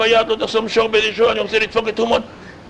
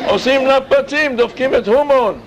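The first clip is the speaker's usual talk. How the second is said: excited; 6 semitones higher than usual. Acted. Noise at Haman's name.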